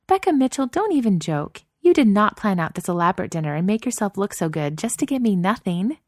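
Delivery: put-on playful outrage as the girlfriend; indignant girlfriend voice. Narrator voices Chloe.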